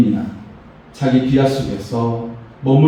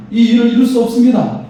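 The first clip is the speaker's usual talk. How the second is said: lightly voiced fretful worker, higher; complaining townspeople; 7 semitones higher than usual. Preacher acting the people's despair to the congregation, higher and fretful. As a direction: say it as the discouraged people, pitched up. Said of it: "그렇게 말을 하고 있는 것입니다".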